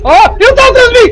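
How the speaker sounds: high, loud frightened cry; panicked shout. Real, not acted. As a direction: yell in sudden terror. Monster behind him in game.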